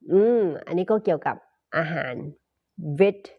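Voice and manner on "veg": ordinary talk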